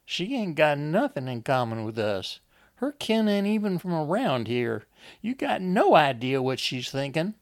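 high, insistent country woman; insistent Lizzie. Acted. Lizzie turns Enoch against Wanda, high and insistent. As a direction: win him over, voice rising and forceful.

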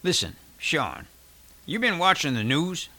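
earnest question, pitch rising at the end; mildly serious, leaning into the question; grown serious. A narrator's reading. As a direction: get down to business with a friend. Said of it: "Then he grew serious".

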